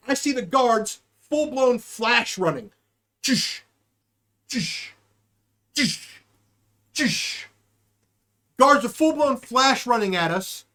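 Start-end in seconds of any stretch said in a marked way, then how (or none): 3.2-7.5 short hushed "tsh" bursts, evenly spaced; imitated speed whoosh. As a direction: mimic cartoon super-speed running. Imitating guards' flash-speed running.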